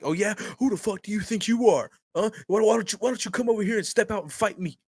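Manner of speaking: high, goading mock tirade; mock belligerent challenge. Customer daring the manager to step out and fight, mock belligerent. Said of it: "It's like going off".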